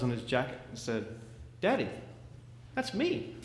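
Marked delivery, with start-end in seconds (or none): 1.6-3.3 put-on high little boy's voice, timid; a small son speaking up quietly; small child voice. Little son telling his dad, small high child voice.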